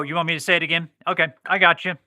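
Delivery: ordinary delivery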